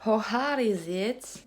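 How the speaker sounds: slow, drawn-out English accent; practised English accent. Shown as a demonstration. Vlogger training her English while struggling, drawn-out English accent. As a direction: stretch it out in your best English accent. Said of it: "j'essaie d'entraîner mon accent anglais".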